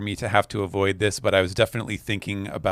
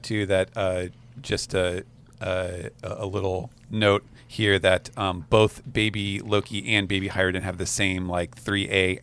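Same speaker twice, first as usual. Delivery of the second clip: normal talking voice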